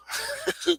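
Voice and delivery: quick high laugh about his wife; high chuckle. Real, not acted. Host joking that his wife runs the stream, high chuckle.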